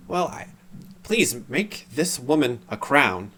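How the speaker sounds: dazed, drawn-out request; weird unconscious voice. Acted. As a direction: speak as if not quite conscious. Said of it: "unconscious quality to his voice".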